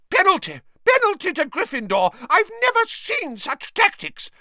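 high, furious referee screech. A narrator's reading. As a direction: screech it in outrage. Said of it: "Madam Hooch screeched".

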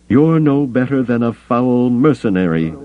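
high, angry snarl. A narrator's reading. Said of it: "he snarled".

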